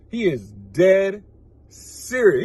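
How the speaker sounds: ordinary talk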